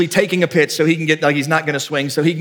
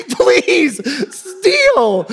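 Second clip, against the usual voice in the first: high, desperate coach's plea; pleading yell; 13 semitones higher than usual. Retold or imitated. Coach begging the kid to run, high pleading shout. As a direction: beg at the top of the voice, hammed up.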